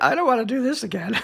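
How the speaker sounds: high, quick, laughing little protest; embarrassed laughing whine. Retold or imitated. Embarrassed teen on the course, high laughing whine. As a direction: laugh through a sheepish complaint. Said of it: "I was so embar".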